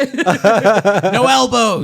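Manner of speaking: slow, loud, high ref call; hammed-up UFC referee. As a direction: drag it out like a ring announcer. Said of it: "sounds like a U F C referee".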